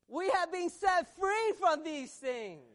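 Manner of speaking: high, loud declaration; emphatic preaching. Real, not acted. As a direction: proclaim the victory to the congregation. Preacher declares freedom to congregation.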